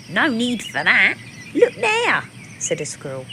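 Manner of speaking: the squirrel spotting clues; chirpy squirrel. Acted.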